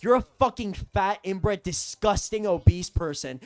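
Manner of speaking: loud, emphatic string of insults; retold angry outburst. Boyfriend to mom's friend on phone, retold angry tirade. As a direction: replay losing it, hitting each insult hard. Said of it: "And I lost it".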